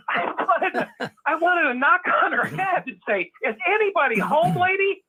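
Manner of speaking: high, laughing disbelief; laughing through words. Real, not acted. Laughing while telling Rick about the lady. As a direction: speak through your own laughter.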